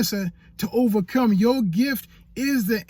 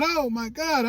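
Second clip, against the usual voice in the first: ordinary talk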